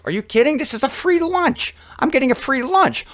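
high-pitched incredulous little bird voice; squeaky-high, disbelieving bird character; tick bird. Acted. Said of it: "The tick bird says".

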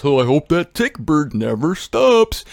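blissful rhino character voice; put-on rhino voice, swelling at the end. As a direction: savour it as the contented rhino. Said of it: "The rhino says".

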